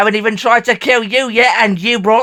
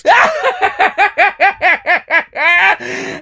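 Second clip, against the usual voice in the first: high gloating villain cackle; evil laugh; 11 semitones higher than usual. Acted. Alien villain gloating at Sarah Jane, evil laugh. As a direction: laugh like you've already won.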